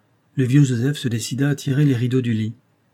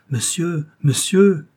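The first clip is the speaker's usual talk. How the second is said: high call, louder on the repeat; anxious calling; 6 semitones higher, 5 dB louder, slower than usual. A narrator's reading. Old servant bends over his master's body, anxious calling. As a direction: call to rouse him, growing urgent. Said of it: "appela-t-il de nouveau".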